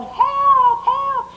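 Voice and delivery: high, piercing cry for help from far off; retold yelling. Startled wife retells hearing a scream at night, high yelling. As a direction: mimic a distant scream for help. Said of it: "I heard somebody yelling".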